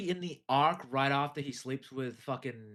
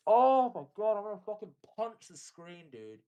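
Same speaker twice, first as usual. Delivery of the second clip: exasperated outburst; 5 semitones higher, 4 dB louder than usual. Real, not acted.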